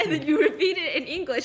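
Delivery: normal talking voice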